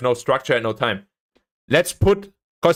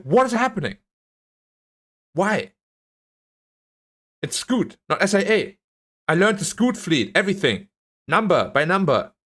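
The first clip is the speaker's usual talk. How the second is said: venting disbelief he got the wrong question; exasperated rant; 2 semitones higher than usual. Retold or imitated. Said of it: "I was like".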